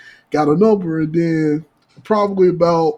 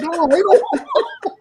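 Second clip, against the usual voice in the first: mock high pleading woman; 4 dB louder than usual.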